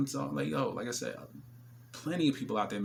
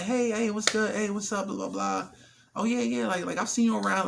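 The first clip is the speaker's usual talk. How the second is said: higher eager "hey, what's good"; women coming back; 10 semitones higher, 4 dB louder than usual. Retold or imitated. Women who refused him now coming back, eager. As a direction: play the sudden friendly return. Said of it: "coming back like".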